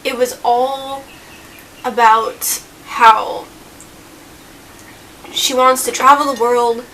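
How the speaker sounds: ordinary delivery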